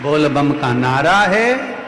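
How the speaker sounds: loud, drawn-out devotional call; slogan call. Real, not acted. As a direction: call it out slowly, rising, to the crowd. Preacher calls out devotional slogan. (ज़ोर से, खींचकर लगाया नारा)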